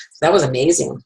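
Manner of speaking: ordinary talk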